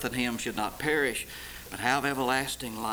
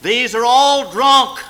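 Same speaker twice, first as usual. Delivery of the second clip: mock crowd jeer; 10 semitones higher, 16 dB louder, slower than usual. Quoting the mocking crowd.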